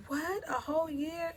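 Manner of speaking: high-pitched, acted disbelief; shocked sister. Sister hears of a year off work, mock shock.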